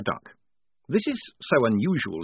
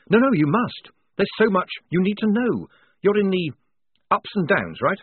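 high, louder pleading voice; insistent writer, urgent; 8 semitones higher, 4 dB louder than usual. Acted. Writer insisting the squad hear him out, urgent. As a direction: press the point, pitched up.

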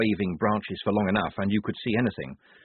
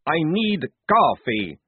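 moaning; 7 semitones higher, 6 dB louder, slower than usual. A narrator's reading. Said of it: "moaned Maledict".

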